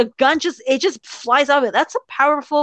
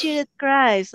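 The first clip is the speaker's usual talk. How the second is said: Judith; 3 semitones higher, slower than usual. Acted. Fan mocking the hat pull, hushed mock-cool introduction. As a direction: murmur it self-importantly, sending up the character. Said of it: "the way she pulls the hat".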